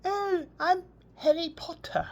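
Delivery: high mock British child's voice; a little boy putting on a British accent; imitating a child's British accent. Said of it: "he talks in a British accent".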